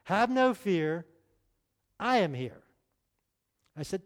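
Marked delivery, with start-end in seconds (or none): 0.0-2.6 slow sing-song rhyme; preacher reciting his little rhyme to the congregation. Shown as a demonstration. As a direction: drawl out no fear, then land on here. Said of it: "let me share it with you first".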